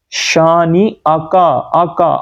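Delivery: "شانی آکا" slow with a catch before آ; hamza sounded. Shown as a demonstration. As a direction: split "شانی آکا" at the glottal stop. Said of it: "حمزہ کی آواز ہم skip کر".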